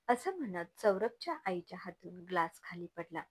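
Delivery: plain reading voice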